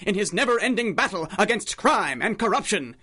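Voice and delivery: big radio serial announcer read, lifted and loud. Acted. Announcer introduces the Red Panda episode with dramatic flair. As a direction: sell the hero's legend over the music.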